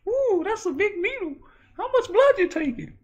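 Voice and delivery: very high, loud nervous squeal; hammed-up needle panic; squeaky dread. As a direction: plead in a high son voice.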